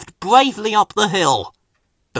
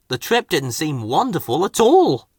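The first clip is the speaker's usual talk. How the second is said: ordinary delivery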